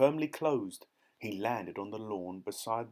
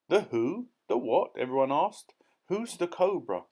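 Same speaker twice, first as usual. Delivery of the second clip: surprised asking; 6 semitones higher, 5 dB louder than usual. A narrator's reading. Everyone asked in surprise.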